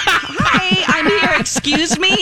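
high-pitched mock-polite interjection; pointed interruption. Acting out interrupting the gossiping group.

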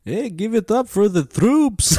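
high, slow, loud vampire call; a hack vampire begging for applause; hammed-up vampire emcee; Count Hacula. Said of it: "dumb hack shit in a vampire voice".

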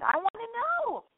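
ordinary delivery

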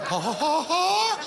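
fake laugh. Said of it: "She was like".

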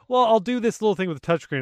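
imitated eager-to-please reply; placating developer. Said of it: "He's like".

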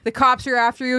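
ordinary delivery

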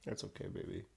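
soft, low soothing coo; calm her like a frightened pet; soothing a scared dog. Pretend. Comforting bed-wetting girlfriend.

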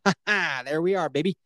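ordinary talk, nothing marked

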